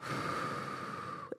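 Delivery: long, soft exhale; calming sigh. Shown as a demonstration. Coach showing how to take a moment, long calming sigh. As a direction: let all the air out slowly. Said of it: "take a moment and go".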